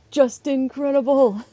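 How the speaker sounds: high, slow, stretched-out name call; playful ring announcer. Pretend. Streamer trying out a wrestling name, hammed-up announcer call.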